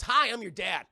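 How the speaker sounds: loud, high comic introduction; hammed-up greeting. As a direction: announce yourself with mock cheer. Acts father's sudden introduction.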